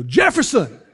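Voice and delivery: high, loud call of Jefferson across the yard. Retold or imitated. Neighbor calling across the yard, loud and high. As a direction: shout the name to a neighbor in the rain. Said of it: "hearing him go".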